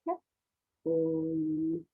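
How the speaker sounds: "K", then a low, held "Oi"; sounding out. Shown as a demonstration. Teacher reading with children, "K" and "Oi" separately before blending. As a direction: read it sound by sound, "K", "Oi". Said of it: "See if you can read it with me".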